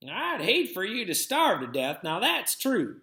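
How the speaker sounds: lightly voiced grandmother offering food; Granny. A narrator's reading.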